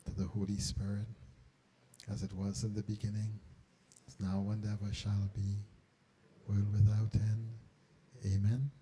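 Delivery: softly recited prayer; hushed, devout recitation after the meditation; quiet prayer. Acted. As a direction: murmur the Glory Be with reverence.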